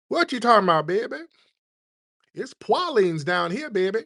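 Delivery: high Louisiana local setting someone straight; Louisiana accent. Acted. Louisiana local answering the one correcting them, high Louisiana accent. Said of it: "That's how they say it".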